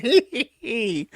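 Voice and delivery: high, slow spectre's tee-hee; mock evil giggle. Spectre poking Ricada with hot stakes, gleeful mock cackle. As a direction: giggle like an imp prodding its victim. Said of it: "poking him with hot stakes, going".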